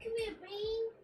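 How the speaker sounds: high, soft pet-talk voice; soft, high sing-out to a pet; coaxing a pet. Real, not acted. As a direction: coax gently in a small high voice. Coaxing pet to come over.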